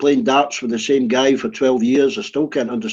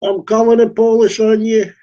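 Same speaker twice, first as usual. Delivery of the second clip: mock Scottish accent, higher and louder; a Scottish woman threatening to call the police; 9 semitones higher, 4 dB louder than usual. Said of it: "I can only do Shandon's accent".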